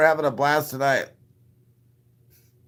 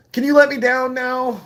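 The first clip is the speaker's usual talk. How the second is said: high, louder little-man whine; high mock plea to be put down; small pleading voice; 13 semitones higher, 6 dB louder than usual. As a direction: squeak like someone held up off the ground. Dangling, pleading with big woman.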